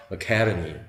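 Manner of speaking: "academy" as uh-KAE-duh-mee; weak first vowel. Shown as a demonstration. Teacher demonstrating unaccented vowels, "academy" starting with schwa. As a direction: say "academy" with stress on the second syllable. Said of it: "이렇게 발음하는 거예요".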